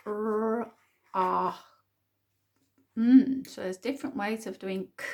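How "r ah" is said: drawn-out r, then a separate ah; sounding out syllable. Shown as a demonstration. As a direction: sound out r, ah, one by one. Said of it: "First syllable".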